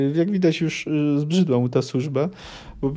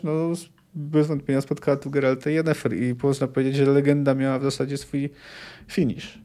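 ordinary delivery

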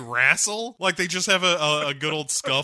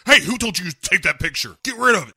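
mock angry lieutenant; 3 semitones higher, 6 dB louder, faster than usual. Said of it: "he's immediately like".

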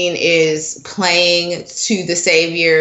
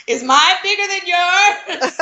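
high-pitched, giggly retelling of a line; laughing quote; 10 semitones higher than usual. Retold or imitated. Co-host giggling over her favourite line from the episode, laughing quote. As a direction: quote it through your laughter, voice climbing high.

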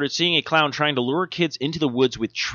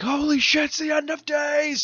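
high panicked shout of doom; 4 dB quieter than usual. Retold or imitated. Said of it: "just be like".

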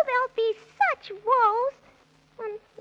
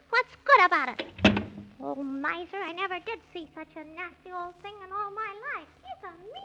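low, fast muttering under the breath; muttered grumble; 5 semitones lower, 6 dB quieter than usual. Acted. Squirrel grumbling about the miser as he leaves, muttered. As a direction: grumble to yourself, quick and much softer.

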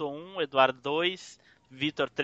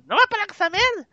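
high mock yell of an annoyed wife; 6 dB louder, faster than usual. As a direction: shout it like a fed-up wife from the next room. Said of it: "a esposa tá no fundo gritando".

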